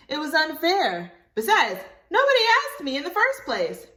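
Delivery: put-on high, loud earnest little girl; child voice. Libby apologizing to Willie, high and loud child voice. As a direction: own up eagerly like a child.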